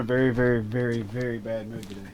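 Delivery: trailing off quietly. Real, not acted. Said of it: "was that my outside voice".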